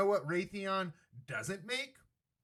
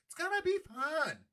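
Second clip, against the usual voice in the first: much higher, squeaky mock delight; mock glee; 12 semitones higher than usual. Host sarcastically selling West Bank property game, mock glee. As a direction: say it again way up high, gleefully fake.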